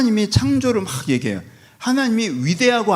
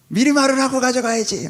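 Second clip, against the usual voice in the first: high, pitched-up protest; mock indignant complaint; 9 semitones higher than usual. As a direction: scold the lender for not warning first. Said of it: "막 이렇게 할 스타일".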